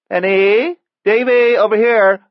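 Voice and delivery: high, loud holler across a distance; calling out. A narrator's reading. Aunt calls children over from the cab, high loud holler. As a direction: sing out the names to get attention.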